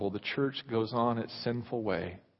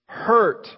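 Hurt, louder and higher; stress on hurt; 9 semitones higher, 10 dB louder than usual. Shown as a demonstration. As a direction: land hard on Hurt. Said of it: "God says".

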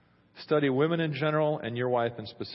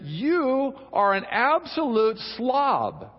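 mock wife accusing; 7 semitones higher, 4 dB louder, much slower than usual. Wife voiced insulting husband.

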